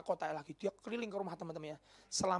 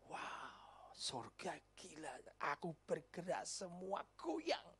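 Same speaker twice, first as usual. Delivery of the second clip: hushed proud Lucifer; 11 dB quieter, much slower than usual. Acted. Lucifer admiring himself.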